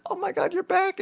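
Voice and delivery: high-pitched imitation of a delighted greeting; delighted surprise. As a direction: imitate her squealed surprise at seeing you. Retold delighted greeting on his return.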